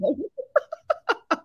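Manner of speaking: long high laugh. Real, not acted. Coach laughing at the angry customer story. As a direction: laugh at your own story.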